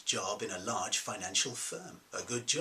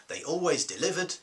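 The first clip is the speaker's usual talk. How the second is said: retold defensive answer, higher pitched; insistent repeat; 7 semitones higher, 4 dB louder than usual. Defendant pressed at the hearing about his decision, insistent. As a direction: repeat the stock answer firmly, pitch raised.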